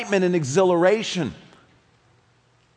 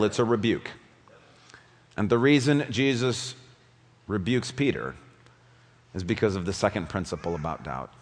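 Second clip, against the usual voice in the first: usual speaking voice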